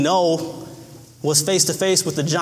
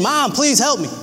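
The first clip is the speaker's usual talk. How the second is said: mock child's plea, pitched high; imitated crying plea; 6 semitones higher than usual. Preacher voices a trapped boy calling to mom, mock crying plea. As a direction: act out a boy begging for help, higher. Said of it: "He was crying".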